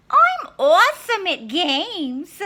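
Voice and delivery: put-on high, loud, slow bragging girl; Little Red. Little Red answering the wolf's game, high and proud.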